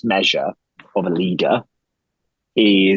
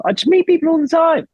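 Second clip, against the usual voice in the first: high-pitched, louder exasperated retort; incredulous, squeaky-high comeback; 4 dB louder than usual. Retold or imitated. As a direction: protest it as if it's obvious, voice shooting way up. Answering people who complain to him.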